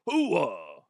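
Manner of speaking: slow, lightly voiced Pacino hoo-ah; a hint of Al Pacino, drawn out. Slow hint of Pacino while admitting he can't do him. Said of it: "I can't even do Al Pacino".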